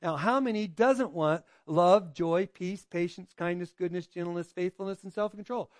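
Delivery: ordinary delivery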